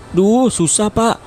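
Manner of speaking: put-on high whiny kid voice giving up; squeaky child complaining to teacher; whining pupil. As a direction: whine it like a stuck schoolkid.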